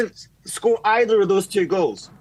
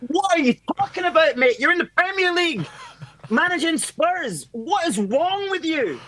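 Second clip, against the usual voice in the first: high, fast, laughing disbelief; incredulous players; 8 semitones higher than usual. Retold or imitated. Danish youth players mocking the Spurs coach, incredulous high laughing voice. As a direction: squeal it like you can't believe your ears, cracking up. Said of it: "They're like".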